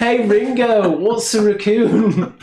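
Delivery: put-on high, loud Liverpool accent. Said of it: "you're the Beatle now".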